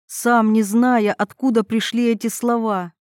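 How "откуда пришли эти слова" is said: ordinary delivery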